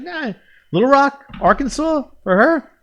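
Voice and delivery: high-pitched, drawn-out mock reluctance; Larry David. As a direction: balk in a high, hesitant comic voice. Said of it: "him being Larry David".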